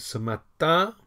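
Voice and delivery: ce matin, said clearly in French, "matin" rising; French model phrase. Shown as a demonstration. Teacher modelling ce matin for learners, clear French. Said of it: "Ce matin et ce soir".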